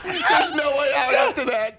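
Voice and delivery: high, slow words through laughter; helpless laughing exclamation. Real, not acted. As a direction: crack up while reacting to the story. Host cracking up at story.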